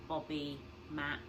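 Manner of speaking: ordinary delivery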